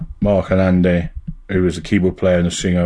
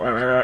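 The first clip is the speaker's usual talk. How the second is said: mock goose alarm calls, pitched up; quick imitation of squawking geese; imitated goose honking; 4 semitones higher, 3 dB quieter, faster than usual. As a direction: honk like agitated farm geese. Imitating the farm geese raising alarm.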